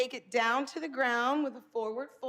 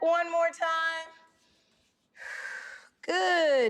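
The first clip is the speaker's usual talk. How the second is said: higher, louder breath cues; yoga cueing; 4 semitones higher, 4 dB louder than usual. Acted. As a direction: count the breaths encouragingly. Cat-cow breath cues.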